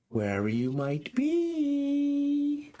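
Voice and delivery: high, slow crone proclaiming; old woman voice. Acted. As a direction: stretch it out like an old witch calling. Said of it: "elderly woman's voice".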